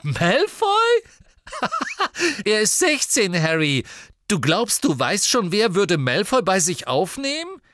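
loud, high, incredulous guffawing; hooting with derisive disbelief; bursting into laughter. A narrator's reading. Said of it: "Dann brach Ron in Gelächter aus".

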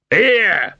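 loud, high shout. Acted. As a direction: yell after him. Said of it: "he shouted".

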